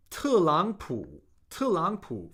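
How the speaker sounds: 特朗普 as falling fourth tone, then two third tones; tone demonstration. Shown as a demonstration. Chinese teacher modelling Trump's name, 特朗普 in careful fourth-third-third tones. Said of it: "fourth tone, third tone, third tone".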